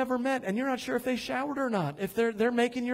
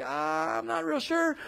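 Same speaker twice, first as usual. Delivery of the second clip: higher-pitched mock hesitancy; Thomas, curious and unsure; 5 semitones higher, 3 dB quieter than usual. Preacher mimics Thomas before he commits, higher tentative voice. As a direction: waver lightly, not committing. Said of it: "where Thomas goes from curious".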